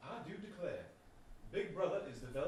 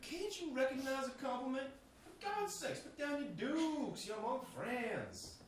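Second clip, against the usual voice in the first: mock; 8 semitones higher than usual. Mocking plea to calm down.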